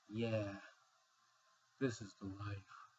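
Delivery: talking through a yawn; words stretched by a yawn. Real, not acted. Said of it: "Sorry if I'm yawning so much".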